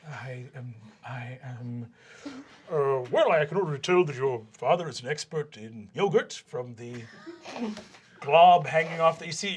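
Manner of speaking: ordinary talk, nothing marked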